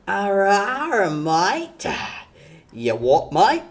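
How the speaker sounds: hammed-up Australian bogan growl; bogan Aussie accent. Viewer mocks Aussie bogans in a hammed-up accent. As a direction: play a tough Aussie bogan. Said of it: "the accent".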